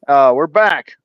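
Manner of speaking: loud, proud welcome back; loud return. Real, not acted. Host greeting viewers after a week off, loud announcement. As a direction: announce the comeback loudly.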